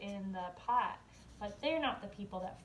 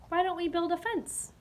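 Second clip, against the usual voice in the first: cheerful suggestion; 8 semitones higher, 4 dB louder than usual. Acted. Daddy suggests idea.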